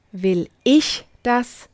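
ich weighted, slow and deliberate; stress on ICH. Shown as a demonstration. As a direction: put the weight on ich, slowly. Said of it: "alle drei Betonungen mit Ja beantworten".

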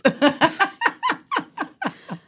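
long, high-pitched laugh. Real, not acted. Film fan after calling the story super intense, high laughter. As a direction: let a high laugh run on.

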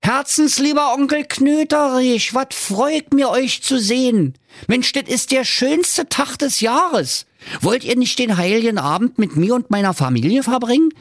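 a beaming nephew gushing an invitation; high, overjoyed nephew inviting his uncle; cheerful nephew. A narrator's reading. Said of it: "und sprach erfreut".

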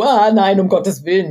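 a burst of loud, high mock horror; playful shock at your own recording. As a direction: gasp it out like hearing yourself on tape.

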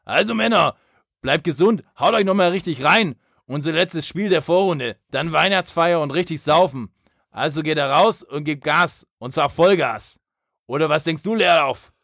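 hoch und laut anfeuernd; geschriene Kabinenansprache. Retold or imitated. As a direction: Ansage wie ein Trainer schreien. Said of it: "[name] schreit die Ansage in die Höhe". (geschriene Kabinenansprache)

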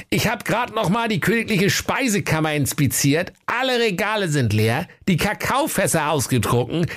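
hohe, unterwürfige Dienerstimme; hoch und eifrig berichtender Diener; Hofmarschall. Acted. Hofmarschall Hering spricht. (unterwürfiger Diener mit hoher Stimme)

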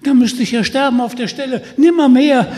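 höher, schnell, entsetzt. Acted. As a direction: wehr dich panisch. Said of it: "schrie Peter mit Entsetzen". (Peter weist Michel panisch ab, entsetzt)

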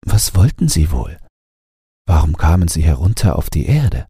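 plain reading voice

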